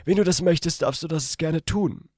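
put-on aroused young man. A narrator's reading. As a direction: say it with desire. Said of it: "Vor Erregung und Begierde sagte ich".

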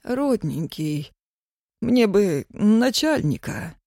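slow, quivering plea; pitiful trembling voice. Acted. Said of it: "жалобным дрожащим голосом попросил Ёшка".

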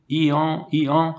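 high imitated hee-haw; donkey bray. A narrator's reading. Poor donkey tries to bray back to his master, short high braying. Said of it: "essaya de braire".

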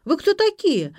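loud, high indignant yell; high-pitched outcry, acted by the narrator; shouting. A narrator's reading. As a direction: yell at strangers in alarm. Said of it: "закричала Ксюша".

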